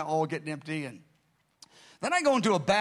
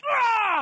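sudden piercing high scream, much louder; sudden cry; 6 dB louder than usual. Retold or imitated. Man struck during prayer.

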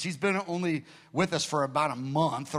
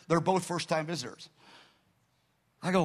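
normal talking voice

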